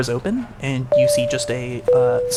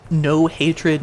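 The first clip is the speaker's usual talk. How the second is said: usual speaking voice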